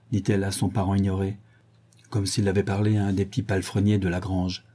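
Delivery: usual speaking voice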